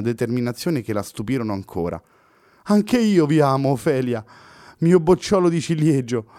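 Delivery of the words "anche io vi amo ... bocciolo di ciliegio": can't believe his ears; Pessoa exclaiming in disbelief. A narrator's reading. Poet answering her love, incredulous exclamation. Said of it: "esclamò incredulo Pessoa".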